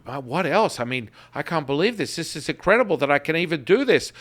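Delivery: a woman raving about her own progress; excited subscriber. Retold or imitated. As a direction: play the thrilled client who can't believe it. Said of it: "She's like".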